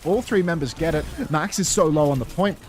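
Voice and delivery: excited play-by-play. Acted. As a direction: call the teamfight with rising hype. Casts a teamfight, building.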